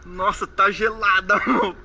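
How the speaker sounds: loud, slow shivering gasps. Real, not acted. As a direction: shudder through the cold words. Entering freezing water.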